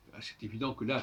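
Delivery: normal talking voice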